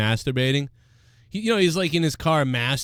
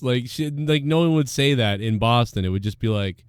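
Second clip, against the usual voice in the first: ordinary talk, nothing marked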